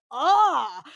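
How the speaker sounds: loud, drawn-out "ah"; mock outraged cry. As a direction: cry out in theatrical dismay. Acting out her shocked reaction.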